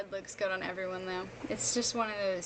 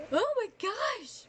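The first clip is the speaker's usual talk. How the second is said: surprised; 11 semitones higher, slower than usual. Real, not acted. Noticing how late it is.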